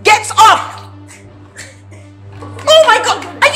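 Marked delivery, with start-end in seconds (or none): 0.0-0.8 higher-pitched shouted command; angry shout. Acted. Employer ordering maid up, angry shout.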